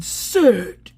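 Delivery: high, loud, explosive command; mock street-fighter yell. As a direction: blast it like a fighting move. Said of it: "are you a street fighter?".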